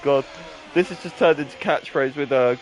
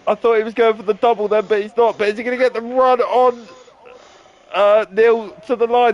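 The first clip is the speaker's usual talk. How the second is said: raised pitch, urgent play-by-play; excited race commentary; 6 semitones higher than usual. Real, not acted. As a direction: call a tight finish as it happens.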